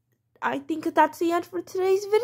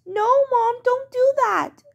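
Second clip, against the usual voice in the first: high, whiny plea; pleading child; 6 semitones higher than usual. Acted. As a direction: beg mom not to punish more. Child begs mom.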